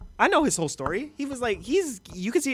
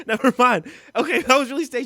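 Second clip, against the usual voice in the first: laughing; 8 semitones higher, 5 dB louder than usual. Real, not acted. Laughing at the clip.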